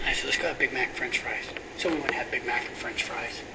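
hushed sermon story. Acted. Pastor retelling his night talk with Jerry, hushed. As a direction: draw the congregation in with a hush.

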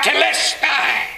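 high, stretched cry at the finish line; victory cry. Acted. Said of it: "raise their hands in victory and cry out".

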